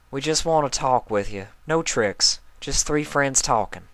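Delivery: a lightly voiced farm pony; Applejack voice. A narrator's reading.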